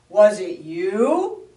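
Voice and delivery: loud, slow, grumpy growl; snarling bear. Acted. Bear woken from winter sleep snarls at the animals. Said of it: "he said".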